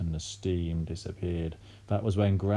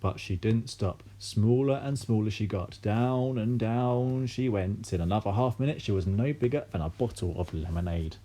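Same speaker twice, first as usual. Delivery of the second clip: ordinary delivery, nothing marked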